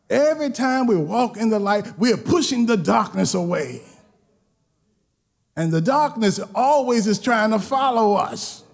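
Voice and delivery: normal talking voice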